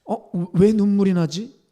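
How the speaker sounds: loud, high, startled self-question; bewildered at tears. Retold or imitated. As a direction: act out puzzlement at sudden tears. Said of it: "눈물이 쏟아지기 시작했어요".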